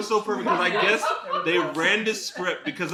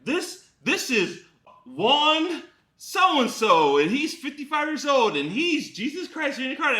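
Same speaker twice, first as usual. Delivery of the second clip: mock reverent introduction; 9 semitones higher than usual. Cult members' solemn introduction acted.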